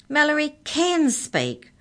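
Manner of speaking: slow, high, loud insistence; shout. A narrator's reading. Arthur shouting at the Hex Checker, slow and loud. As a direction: shout it back in protest. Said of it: "shouted Arthur".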